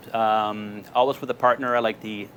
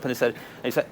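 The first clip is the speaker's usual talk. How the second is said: ordinary delivery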